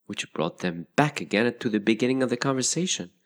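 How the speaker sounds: usual speaking voice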